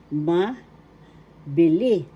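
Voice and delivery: "mabili" slowly, pause after "ma", then "bili"; slow, split word. Shown as a demonstration. Teacher introducing mabili to learners, slow and split. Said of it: "third syllable li".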